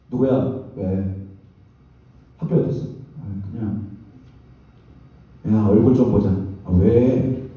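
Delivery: low, sullen mealtime exchange; curt family exchange. Acted. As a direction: act out a glum parent and child, pitched low. Acts out glum family mealtime talk. (낮고 퉁명스러운 가족 대화 흉내)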